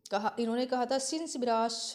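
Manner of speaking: normal talking voice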